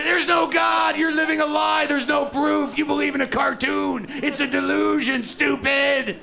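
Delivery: high, loud jeering shout; hammed-up atheist yelling from the bushes; ranting atheist. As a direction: ambush him with a shrill rant.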